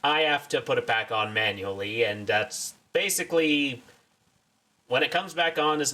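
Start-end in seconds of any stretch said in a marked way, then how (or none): none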